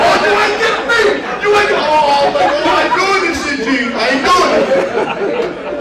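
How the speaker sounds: high and loud, hammed-up protest; mock panicked refusal. Coach retells refusing to be taken hostage, mock panicked refusal.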